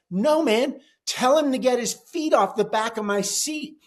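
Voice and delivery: high, heated protest. Retold or imitated. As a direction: insist hotly over the complaint. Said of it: "my voice is very raised".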